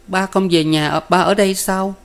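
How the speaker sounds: put-on high-pitched toddler's question; small child's voice. A narrator's reading. Toddler asks jailed father to come home, small child's voice. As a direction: ask innocently in a high child voice. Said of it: "Cao Thanh Báo hỏi rằng".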